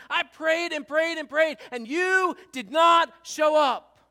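a believer crying out to God in pain; pained shout. Acted. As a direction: shout it, slowing on the last words. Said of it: "Do you hear the pain now".

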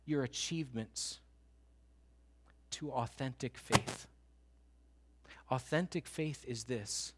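soft, slow, intimate appeal to the congregation; hushed altar call. Acted. Preacher to congregation, a hushed, slow altar call. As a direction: lean in and draw them to surrender.

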